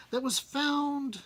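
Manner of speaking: usual speaking voice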